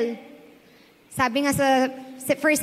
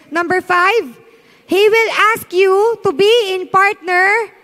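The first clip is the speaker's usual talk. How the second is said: louder, steady declaring, slowing at the end; emphatic sermon list; louder preacher list; 4 semitones higher, 5 dB louder, slower than usual. Acted. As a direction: hammer each numbered point home. Lists the points at full force.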